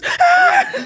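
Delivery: high, loud mock shriek; mimicked scream of fright; imitated startled scream. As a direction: yelp like someone scared by a bang. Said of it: "scare the shit out of her".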